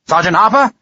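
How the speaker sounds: calling out. A narrator's reading. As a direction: call the sergeant with a lifting end. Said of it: "he bellowed hugely into the night".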